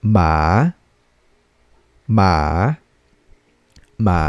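long "Ma" dipping low, then rising; third tone. Shown as a demonstration. Teacher shows students Mandarin third tone, "Ma" falling then rising. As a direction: let "Ma" sink down and climb back up. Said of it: "going down and going up again".

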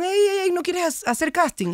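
high, lilting mock call-out; playful imitated "hey, come here"; calling her over. Voices crew calling her over.

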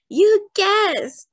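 put-on very high, loud child's cheer; delighted exclamation. A narrator's reading. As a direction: burst out, thrilled at being guessed right. Child delighted that mama guessed.

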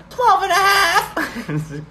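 put-on high, loud, drawn-out kid boast; mock-childish high holler; child proud of halves. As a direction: shout it like a self-important child. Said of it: "I remember when halves were so important".

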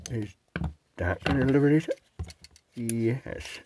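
talking around a torch in his mouth; words muffled by a torch held in the teeth; mouth full. Real, not acted. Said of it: "I got a torch in my mouth".

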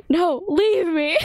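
slow, high hammed-up farewell plea; mock dramatic plea. Imagining a friend's tragic goodbye, hammed-up slow plea. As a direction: overplay a tragic goodbye, drawn out.

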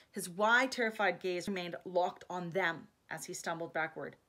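plain reading voice

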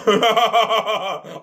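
high burst of laughter; high giggle. Real, not acted. The host cracks up at his own nonsense with a giggle. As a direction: let a giggle escape.

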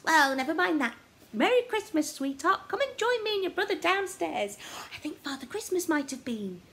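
bright loud granny's greeting; cheerful Gran. A narrator's reading. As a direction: call the boy down with Christmas cheer. Gran voiced in story.

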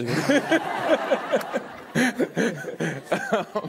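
high embarrassed laugh rising; burst of laughter. Real, not acted. Guest caught fumbling her answer, laughing high. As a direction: laugh it off, caught out mid-sentence.

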